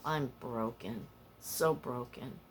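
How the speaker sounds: soft, slow, low "broken"; quiet sad admission. Real, not acted. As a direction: let it sink, drained. Admitting loneliness, sad.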